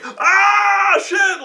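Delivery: high, drawn-out scream of shock; mock scream. Game master acting out the startled Decker, mock scream. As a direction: yelp it out as an imitation. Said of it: "starts screaming".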